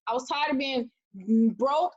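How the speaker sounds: ordinary talk, nothing marked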